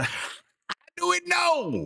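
repeating the punchline through a laugh, high and slow; chuckling mock yokel voice.

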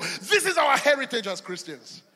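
loud, rhythmic pulpit preaching; preaching at full force. Acted. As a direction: build it like a revival climax.

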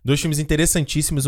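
ordinary talk

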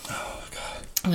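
pained whisper. Real, not acted. Mouth burning from hot pickle.

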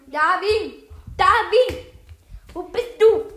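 loud call across the room. Real, not acted. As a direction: shout for him from afar. Calling for missing friend.

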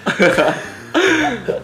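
high burst of laughter. Real, not acted. Laughing at boss reply.

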